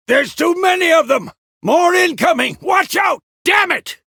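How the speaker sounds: loud, high-pitched combat yell; urgent full-force shouting; battle shout. Acted. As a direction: shout warnings over a fight. Combat warning shouts amid a fight.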